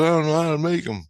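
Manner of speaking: high-pitched imitated child; protesting little kid. Kid answering the train maker, imitated high protest. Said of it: "He goes".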